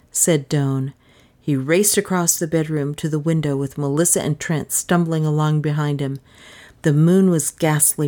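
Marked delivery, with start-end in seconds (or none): none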